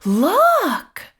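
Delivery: high, loud excited shout; child shouting and pointing; child's shout. Acted. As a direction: call it out like a thrilled child. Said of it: "shouted one of the children".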